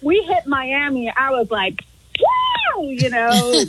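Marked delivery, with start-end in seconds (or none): 2.2-2.8 very high celebratory squeal; excited whoop. Retold or imitated. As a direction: re-enact a thrilled landing cheer. Retelling own cheer on landing.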